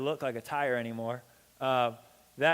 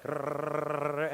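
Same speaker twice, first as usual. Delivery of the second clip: imitated engine groan; 2 semitones lower than usual. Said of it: "the truck is like".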